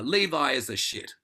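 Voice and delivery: loud, punched angry outburst; a burst of anger at Levi; angry thought. Retold or imitated. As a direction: spit it out like you're fed up with your brother. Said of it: "you're really angry at someone".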